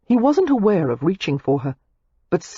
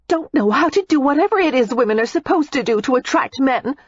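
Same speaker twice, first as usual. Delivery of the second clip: put-on higher, irate young woman; Daisy, irate; 8 semitones higher than usual. A narrator's reading. Daisy complains irately to Matthew. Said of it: "Daisy was saying irately".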